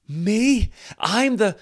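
gasp, then high, loud delighted disbelief; mistaken astonishment. Acted. Preacher plays bystander who thinks he was singled out, astonished. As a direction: think you have been chosen. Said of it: "imagine that person's going".

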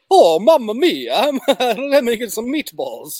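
high, drawn-out, hammed-up Italian accent with a laugh breaking in; mock Italian Mario. Guessing the movie's Mario voice for the co-host, hammed-up Italian accent. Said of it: "But does it sound like".

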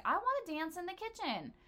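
high-pitched, playful wish; lifted voice, acting out a want; wishful declaration. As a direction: say it high, like an eager daydream. Acting out her romantic wish.

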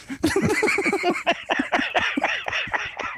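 breathless voiceless laugh, shaking; silent wheezing laugh. Real, not acted. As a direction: laugh with no voice, doubled over. Laughing at own joke.

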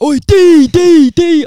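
loud, high mock roar of greeting. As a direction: roar out a mate's name, imitating him. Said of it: "just roaring and that".

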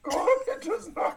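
high, inverted Yoda echo; Yoda impression. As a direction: answer like the tiny master. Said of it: "fun to talk like Yoda".

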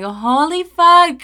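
high, loud, drawn-out exclamation; overwhelmed shock. Retold or imitated. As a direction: stretch it out in acted disbelief. Acted shock as hate messages pile in.

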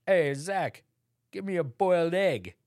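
high, loud fake posh English accent; hammy snooty English master voice; pathetic English accent. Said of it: "What was that voice you were doing?".

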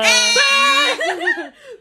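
high loud burst of laughter; delighted laugh. Real, not acted. Host laughing at guest's long country list, loud high laugh.

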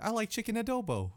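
high, put-on outsider voice. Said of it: "that's how they talk".

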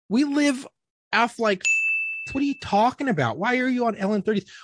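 high-pitched retold exasperation; acted baffled protest; exasperated disbelief. Acted exasperated questioning of wife.

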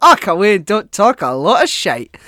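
put-on cranky old man interrupting on the radio; old man heckling. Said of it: "this old man just interjects, he's like".

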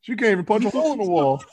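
high, fast heckling voice; mocking old heckler. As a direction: razz her like old hecklers. Statler and Waldorf mocking.

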